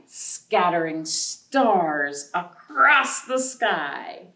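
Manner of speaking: slow, gentle storybook reading; reading to children. Acted. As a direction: read it like bedtime storytime for little kids. Reads picture book to children.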